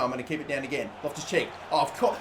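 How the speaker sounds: ordinary delivery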